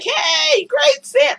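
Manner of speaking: high, shouted mock greeting; mock cheer. To the performer after her set, a high mock shout. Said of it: "And I was like".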